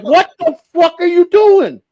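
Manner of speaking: high, slow, laughing disbelief; shocked. Retold or imitated. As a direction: stretch it out, cracking up in shock. Shocked at naked colonel.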